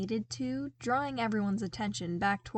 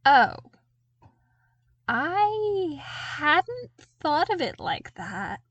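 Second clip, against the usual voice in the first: slow, sheepish admission, pitched up; 7 semitones higher, 6 dB louder, slower than usual. A narrator's reading. Luke realises what he did, slow and sheepish. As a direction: own up a little embarrassed. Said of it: "Luke admitted sheepishly".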